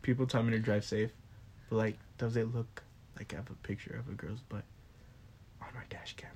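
low, very soft, fading to a whisper; too quiet. Real, not acted. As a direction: let it trail down into near-inaudible whispering. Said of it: "Can you speak up a little louder?".

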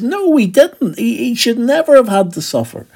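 the two men rejecting a suffering Messiah, high and outraged; indignant protest. Retold or imitated. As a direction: protest like it's unthinkable. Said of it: "They want to say".

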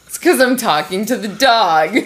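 ordinary talk

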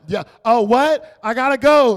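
loud, high brush-off; mock can't-hear shout. Teens in AirPods brushing someone off, loud oblivious reply. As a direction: talk too loudly, as over earphones. Mimics youths ignoring with AirPods.

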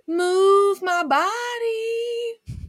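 stretched slow and high; slow drawn out. Retold or imitated. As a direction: draw each word out. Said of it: "Someone said".

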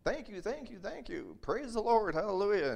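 very high and loud, ecstatic thanks; joyful praise. Acted. Healed beggar thanking Peter and God in ecstatic high shouts. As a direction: shout your thanks to heaven.

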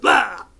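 a hammed-up dying shout; brief cartoonish death yell; mock death cry. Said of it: "and then goes".

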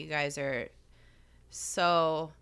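softly moved, low and halting; quiet, heartfelt praise with long pauses; choked up. Real, not acted. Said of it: "I'm, like, choked up".

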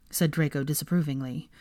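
ordinary delivery, nothing marked

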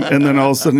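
ordinary talk

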